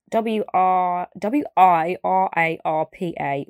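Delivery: each letter spelled out slowly, one by one; spelling out letters. Shown as a demonstration. Vlogger spelling a hard brand name for viewers, slowly. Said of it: "I'm gonna spell it out to you".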